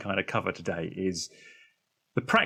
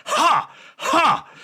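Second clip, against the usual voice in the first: high, much louder hammed-up "ha, ha"; forced loud ha ha; 6 dB louder than usual. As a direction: bark out a big fake laugh. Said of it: "Let's really get some energy going here".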